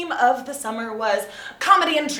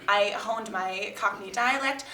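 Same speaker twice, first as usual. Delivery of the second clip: normal talking voice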